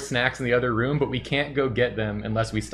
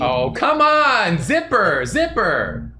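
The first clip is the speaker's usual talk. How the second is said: frustrated yell; 10 semitones higher, 3 dB louder, slower than usual. Real, not acted.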